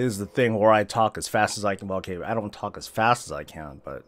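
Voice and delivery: normal talking voice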